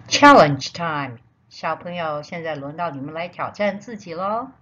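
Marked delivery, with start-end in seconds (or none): 0.1-1.2 loud, slow announcement; announcer voice. Shown as a demonstration. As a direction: call it out big and slow.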